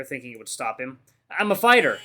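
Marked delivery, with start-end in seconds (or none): 1.3-2.0 high, loud declaration; crying out. A narrator's reading. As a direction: cry it out in desperate protest. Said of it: "As I cry out".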